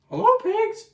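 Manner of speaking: very high squeaky duck. Acted. Ruben as Duck greeting Dog, squeaky high. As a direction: chirp it out. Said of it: "we have to use the voices".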